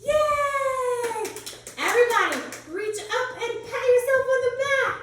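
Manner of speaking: high, loud cheering; excited praise. Real, not acted. Teacher praising kids loudly.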